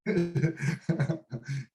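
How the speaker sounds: short chuckle at oneself; self-amused chuckle. Real, not acted. Laughs about crying in cinema.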